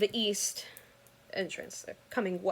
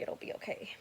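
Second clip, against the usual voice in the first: whispered under the breath; whispering a calming mantra to herself; 11 dB quieter than usual. Retold or imitated. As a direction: whisper it to yourself in shock. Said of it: "I just kept repeating".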